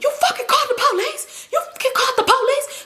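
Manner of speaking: high, laughing mock yell; angry accusation. Man accusing the motel guy.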